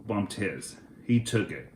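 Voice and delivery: ordinary delivery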